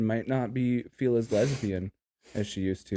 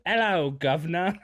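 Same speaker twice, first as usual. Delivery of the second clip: mock British accent; 5 semitones higher than usual. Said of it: "Could you imagine just zip".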